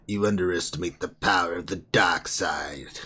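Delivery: low, breathy Sith whisper; dark side whisper. Shown as a demonstration. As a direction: hiss it like a Sith lord. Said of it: "in your dark side voice".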